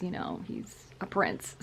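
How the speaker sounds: soft conspiratorial whisper; whispered aside. Real, not acted. Telling viewers a secret aside, whispered.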